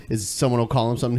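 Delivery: ordinary delivery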